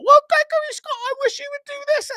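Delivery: very high, loud, shrill-pitched mother scolding; over-the-top high mom voice, half-shouted; nagging mom voice. Retold or imitated. Mom voice in argument.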